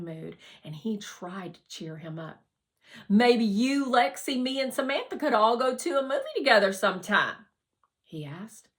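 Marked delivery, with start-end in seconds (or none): 3.1-7.5 bright, much louder, hopeful pitch up; upbeat suggestion. Acted. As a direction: pitch it eagerly to cheer a friend up. Harlan tries to cheer Raymond.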